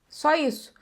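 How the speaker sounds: normal talking voice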